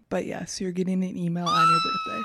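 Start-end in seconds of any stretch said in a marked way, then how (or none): 1.5-2.2 very high squealed reveal; excited squeal. Real, not acted. Friend reveals the birthday gift, squealing high. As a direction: squeal the surprise out.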